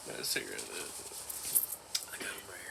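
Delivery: whispered secretly, no voice at all. Real, not acted. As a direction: breathe it out as a secret. Drops to a whisper.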